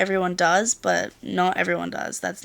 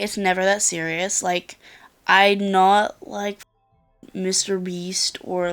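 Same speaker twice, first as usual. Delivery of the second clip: ordinary delivery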